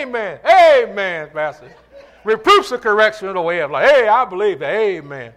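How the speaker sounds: mock congregation response. Models congregation's eager response to quiet room.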